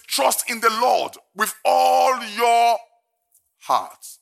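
slow, high shout on ALL; emphatic slow proclamation; shouted scripture. Acted. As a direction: preach it full force. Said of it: "repeating verse with emphasis".

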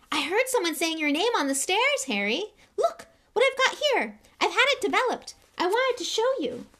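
very high, loud, breathless little fan; eager young boy. A narrator's reading. Young fan Colin rushes to show Harry his photo, very high. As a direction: bounce with excitement at your hero.

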